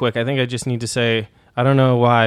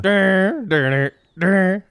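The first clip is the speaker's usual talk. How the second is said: mock dumb voice; 8 semitones higher, much slower than usual. Mocking the sage voice.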